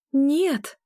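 high, sharp cry of refusal; emphatic high-pitched objection; vigorous protest. A narrator's reading. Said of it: "Я активно запротестовала".

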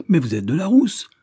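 high, loud retort, swooping upward; indignant shout. A narrator's reading. Voice from the landing challenging the boss, indignant shout. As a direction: cry it out in protest. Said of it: "crie une voix".

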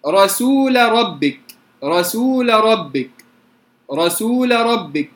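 رسول ربك slow, lam of رسول kept distinct, not merged into ra; clear lam before ra. Shown as a demonstration. Teacher demonstrates to students: رسول ربك slow with a clearly pronounced lam. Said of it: "اللام مفتوحة وقبلها ساكن".

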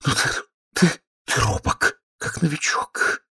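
high, halting, airy teasing; panting feminine coo; breathless murmur. A narrator's reading. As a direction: murmur it in gasps to a shy lover. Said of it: "задыхаясь, бормотала она".

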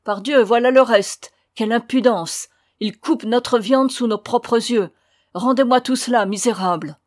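loud, outraged accusation; acted angry outcry; angry exclamation. A narrator's reading. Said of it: "celui qui était en tête s'écria".

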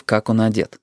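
ordinary delivery, nothing marked

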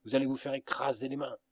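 fast, urgent warning; urgent cry. A narrator's reading. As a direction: rush it out in alarm. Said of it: "m'écriai-je".

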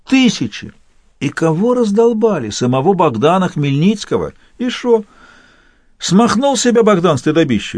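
cheeky soldier holding forth; Cossack artillerist voice. Acted.

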